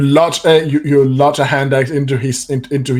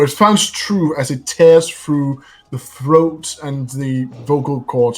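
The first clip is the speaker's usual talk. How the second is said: ordinary talk, nothing marked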